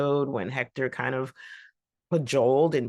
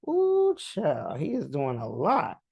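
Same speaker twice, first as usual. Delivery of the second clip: put-on sassy Ooh child; slower than usual. Own reaction, sassy aside.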